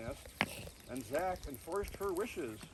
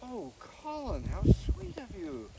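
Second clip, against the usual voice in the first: touched; 7 semitones higher, 6 dB louder, slower than usual. A narrator's reading. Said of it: "Molly couldn't believe it".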